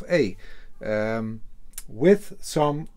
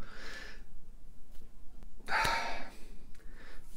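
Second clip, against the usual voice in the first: sigh; 12 dB quieter than usual. Real, not acted. Long sigh before starting.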